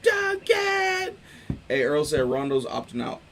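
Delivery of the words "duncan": much higher shouting at a player; yelling from the bench. Retold or imitated. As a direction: holler it across the court. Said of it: "he said they were yelling at it".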